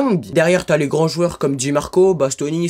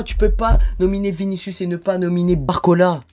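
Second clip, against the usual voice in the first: ordinary delivery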